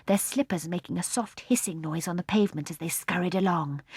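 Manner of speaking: usual speaking voice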